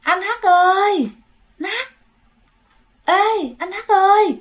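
put-on high, drawn-out girlish call; girl's calling voice. Unseen girl calls to the boy on a deserted road, high drawn-out call. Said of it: "Giọng con gái đàng hoàng kêu".

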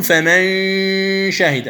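فمن شهد with the ن hidden in a two-count nasal sound; ikhfa ghunna demo. Shown as a demonstration. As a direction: hum the ن of فمن into the ش. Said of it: "هذه غنة زائدة".

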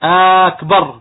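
"أكبر" with the first vowel held long, aak-bar; lengthened hamza. Shown as a demonstration. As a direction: stretch the opening A of "أكبر". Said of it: "إذا مد همزة أكبر".

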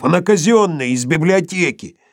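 desperate protest. A narrator's reading. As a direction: plead for the book.

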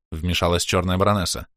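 ordinary delivery

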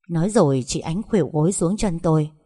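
ordinary delivery, nothing marked

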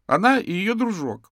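put-on high, loud child's accusation; upset, high-pitched outburst; tearful exclamation. A narrator's reading. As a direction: blurt it out as a boy near tears. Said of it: "со слезами на глазах воскликнул Тим".

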